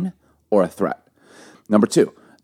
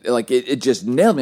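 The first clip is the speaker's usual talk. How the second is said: ordinary talk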